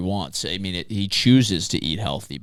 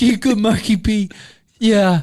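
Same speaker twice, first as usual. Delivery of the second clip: dog voice; 11 semitones higher, 6 dB louder than usual. Pretend. Dog voice bit continues.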